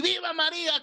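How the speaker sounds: very high mock chant of old men; high hammed-up shout; retold group yell. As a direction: imitate old men crying out together. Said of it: "salieron de allá, de acá".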